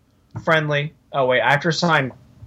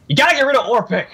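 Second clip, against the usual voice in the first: emphatic demand; 9 semitones higher, 3 dB louder, faster than usual. Real, not acted. Urging a roster move.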